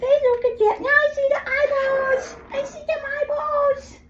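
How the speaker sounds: very high, slow squeaky coo. Real, not acted. Groomer delighting at the dog's uncovered eyes. High baby talk to dog.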